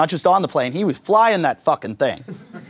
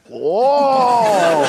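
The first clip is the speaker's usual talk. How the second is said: startled pilot; 6 semitones higher, 3 dB louder than usual. Retold or imitated. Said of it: "The pilot just go".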